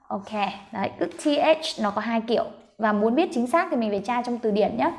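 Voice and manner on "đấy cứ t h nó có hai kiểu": ordinary talk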